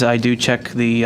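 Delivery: ordinary talk, nothing marked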